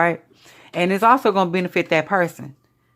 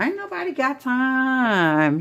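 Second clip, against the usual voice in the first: high, stretched-out comic refusal; slow, sing-out "ain't nobody got time"; sassy catchphrase; 8 semitones higher, much slower than usual. Acted. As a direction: draw it out long and high. Playful catchphrase, drawn out.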